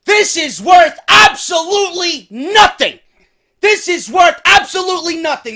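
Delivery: loud, high, disbelieving shouts; outraged shouting. Acted. Host finds the rival's Frisbee in his crate: furious, high shouting. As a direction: yell it like the box insulted you.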